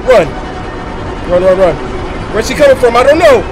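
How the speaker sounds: panicked screaming while fleeing; frantic high shouts, terrified; being chased. Real, not acted. Fleeing granny in horror game.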